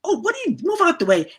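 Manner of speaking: high-pitched, pushy "what are you doing"; impatient high shout. Retold or imitated. Impatient woman pushes past on dark rocks, high and loud. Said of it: "she goes".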